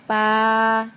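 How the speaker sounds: loud, long sustained baa; sheep bleat. Pretend. Parent prompting the child as sheep, loud long baa. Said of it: "Sheep says ba".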